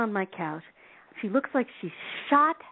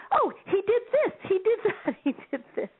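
excited woman; 12 semitones higher than usual. Retold or imitated. Excited woman showing signs.

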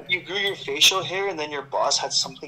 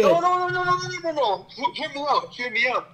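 high-pitched pleading no no no; excited high protest; 11 semitones higher than usual. Real, not acted. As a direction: cut them off, much higher and urgent. Insisting over friends' disbelief.

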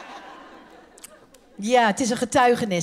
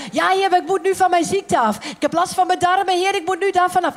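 pleading prayer; 6 semitones higher than usual. Acted. Acting sick person pleading.

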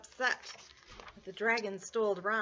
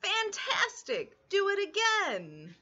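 high, slow cheering fantastic; excited shout; 10 semitones higher, slower than usual. Acted. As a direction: urge the dragon on. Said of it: "Elizabeth shouted".

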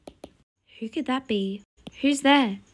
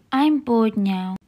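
ordinary talk, nothing marked

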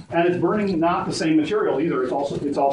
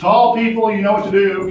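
calling out to group; 4 semitones higher, 6 dB louder than usual. Real, not acted. Guide calls out to whole group.

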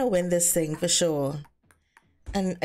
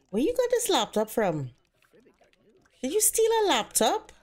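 mock accusing quote; 6 semitones higher than usual. Voicing Ken quoting accusation.

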